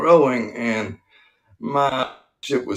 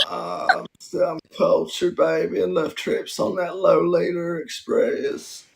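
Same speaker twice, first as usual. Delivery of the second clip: higher-pitched, sly politician impression; Bill Clinton; 5 semitones higher than usual. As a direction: boast like a roguish ex-president. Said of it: "British Bill Clinton".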